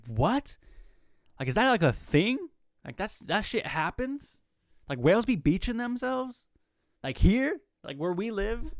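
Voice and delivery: imitating his own shocked reaction to a beached whale; retold disbelief. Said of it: "And I was just like".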